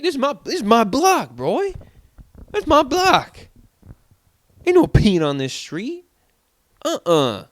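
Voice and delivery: loud, high mock-tough block warning; mock outrage. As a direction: act like a tough guy defending his turf. Mock territorial outrage about his block.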